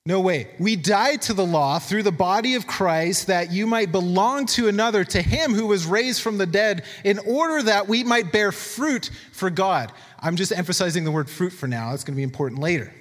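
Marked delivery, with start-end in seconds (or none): 0.6-9.9 slow, raised scripture reading voice. Acted. Preacher reads Romans to the congregation, slow and raised. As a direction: read it out as the word itself, slowly.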